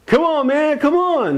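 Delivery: high-pitched, strained retold holler; locked-out pleading. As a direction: imitate calling to be let back inside.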